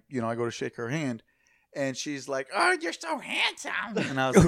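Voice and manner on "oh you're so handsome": high, drawn-out put-on woman's voice; gushing older woman. Older lady complimenting the storyteller at a bar, mock gushing. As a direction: mimic a woman gushing slowly in a high pitch.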